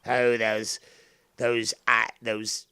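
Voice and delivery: loud, hammed-up villain savouring revenge; gloating villain. Angler taunting the hooked Tony fish, gloating villain. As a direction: relish the victim's struggle, punching key words.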